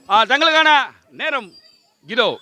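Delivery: loud, high, stretched-out announcer call; game-show announcement. Real, not acted. Host starts the contestant's time, drawn-out announcer call. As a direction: draw it out loudly to launch the round.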